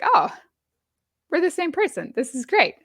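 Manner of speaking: excited quote, pitched well up; delighted recognition. Retold or imitated. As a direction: relive the thrill of finding a kindred spirit. Retelling instant friendship at first meeting.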